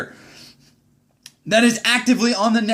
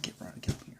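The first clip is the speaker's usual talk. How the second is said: whispered, barely voiced summons; 20 dB quieter, much faster than usual. Retelling his CO calling him in, whispered. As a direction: breathe the order like a hushed boss.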